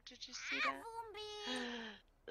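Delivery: high, slow mock alarm with a gasp; startled. Player spotting a stranger at the window, high and slow. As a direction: gasp at something creepy outside. Weird person outside window.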